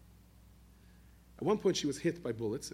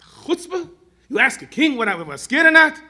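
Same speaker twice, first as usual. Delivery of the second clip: angry king; 12 semitones higher, 16 dB louder, slower than usual. Retold or imitated. King furious at impertinent question.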